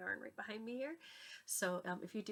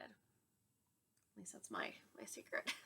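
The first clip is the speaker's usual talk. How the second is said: conspiratorial whisper; 10 semitones lower, 4 dB quieter, much slower than usual. Real, not acted. Sharing her secret tip, whispered.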